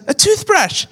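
put-on a small kid proudly answering into the mic; little boy voice. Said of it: "He goes".

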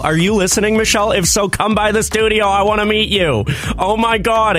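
excited call out. Real, not acted. Co-host calling to the Bachelorette star, excited. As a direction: call out to her, thrilled.

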